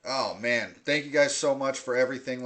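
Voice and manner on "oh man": normal talking voice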